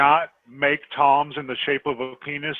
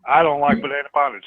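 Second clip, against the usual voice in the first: mock quote of her; 3 semitones higher, 5 dB louder than usual. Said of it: "She said".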